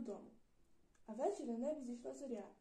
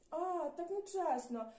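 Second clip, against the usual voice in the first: slow, loud, higher whine; indignant cry; 6 semitones higher, 6 dB louder, much slower than usual. Acted. Caught little devil loudly protesting it's unfair. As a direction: protest that it's not fair. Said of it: "вигукнув неслух".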